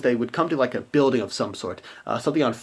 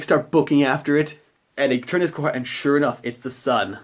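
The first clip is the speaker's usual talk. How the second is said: normal talking voice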